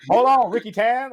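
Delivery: loud, high-pitched movie cop exclamation; Chris Tucker. Retold or imitated. Movie cop walking up talking big, loud high impression. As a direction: yell it high, buddy-cop comedy style. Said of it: "this ain't Jackie Chan and fucking Chris Tucker".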